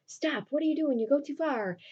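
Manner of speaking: mock-urgent telling-off; urgent objection. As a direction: act out reining in a friend.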